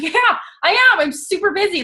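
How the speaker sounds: high-pitched, self-mocking protest; mock-defensive insistence. Childless friend answering mom friends, comically defensive.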